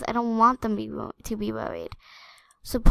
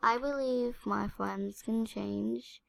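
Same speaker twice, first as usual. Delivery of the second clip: subdued; 8 dB quieter than usual. Real, not acted. Upset, quiet confession after crying.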